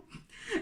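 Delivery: short voiceless chuckle; a little laugh at your own accent; quick, embarrassed little laugh; self-mocking giggle. Real, not acted. Laughs at her English accent.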